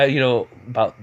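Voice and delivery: plain reading voice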